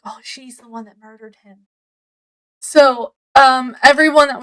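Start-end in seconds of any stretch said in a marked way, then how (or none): 0.0-1.7 hushed retold gossip, much softer; whispering. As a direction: murmur it like office rumour. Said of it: "is all whisper, whisper, like".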